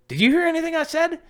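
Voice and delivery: very high, loud, fast screech; wife's sudden outburst. Acted. Wife catches husband zoned out, angry high outburst. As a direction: shriek it out at top pitch. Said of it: "all of a sudden, I heard".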